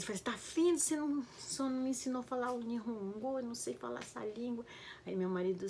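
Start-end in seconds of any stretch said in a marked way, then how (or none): 0.2-4.6 high-pitched retold complaint; mock scolding. Wife arguing with husband over the language, mock scolding. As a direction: nag in a higher voice, as if quarrelling. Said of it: "eu brigava com meu marido".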